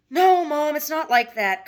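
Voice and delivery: loud, high protest; protesting son. Acted. Son denies to his mother, loud protest. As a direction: cut her off, insisting hard.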